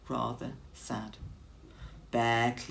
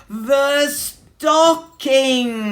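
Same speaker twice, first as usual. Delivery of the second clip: big booming, higher stockings; very loud; 11 semitones higher, 12 dB louder, much slower than usual. A narrator's reading. Bear shouts the hint at oblivious Mouse, very loud and high. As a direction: shout the word like a hint hammered home. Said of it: "Look at the size of the writing".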